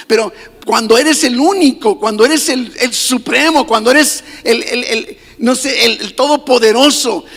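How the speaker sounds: loud, fervent preaching build; preaching at full force. Acted. Preacher proclaiming God's supreme name to the church, fervently. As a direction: pound each phrase to the congregation.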